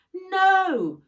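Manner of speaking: loud high cry of no; anguished cry. A narrator's reading. Girl seeing her wolf shot, loud anguished cry. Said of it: "cried Nat".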